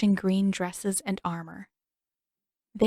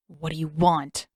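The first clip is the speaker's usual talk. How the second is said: snarled; 4 semitones lower than usual. A narrator's reading. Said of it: "Zuko snarled at the sailor".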